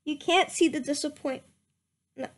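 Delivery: usual speaking voice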